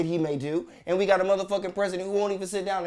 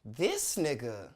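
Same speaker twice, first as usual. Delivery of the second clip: low, drawn-out, falling disbelief; unimpressed catchphrase; 7 semitones lower, 6 dB quieter, much slower than usual. Acted. Comic reacting to stingy chicken scoop, unimpressed. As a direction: sink slowly into weary judgment. Punchline of exasperated complaint.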